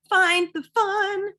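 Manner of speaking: high, slow and loud, savoring each word; excited announcement. Real, not acted. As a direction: sell the title like a headline. Announcing tool title.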